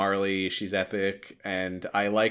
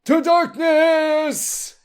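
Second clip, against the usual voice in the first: dramatic slow boom; 8 dB louder, much slower than usual. Acted. Dramatic reading of fic.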